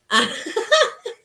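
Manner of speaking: high squealing giggle; ticklish giggle. Real, not acted. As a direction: burst out giggling when tickled. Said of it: "my daughter's trying to tickle me".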